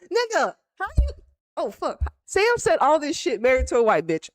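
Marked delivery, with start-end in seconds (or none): 0.0-1.1 high, quick disbelief; hammed-up high-pitched scolding; mock exasperated coach. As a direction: imitate a coach baffled by her player. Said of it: "She was like".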